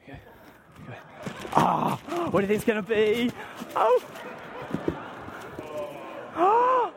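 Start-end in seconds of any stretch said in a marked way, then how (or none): 1.5-3.3 high, eager build-up; pretend excitement. Builds suspense over opening present. 3.7-6.9 amazed gasps. Pretend. Acts amazement while opening present.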